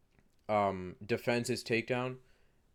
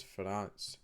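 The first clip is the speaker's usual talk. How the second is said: France said with a sudden Scottish accent; 3 semitones lower, 8 dB quieter than usual. Real, not acted. Vlogger naming a fight, France in Scottish accent. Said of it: "pronounced, uh, France in a Scottish accent".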